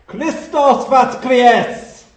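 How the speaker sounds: a full-throated Easter cry in Russian; loud Russian proclamation. Retold or imitated. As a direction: proclaim it in Russian to a huge crowd, every syllable stretched. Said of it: "He spoke loudly".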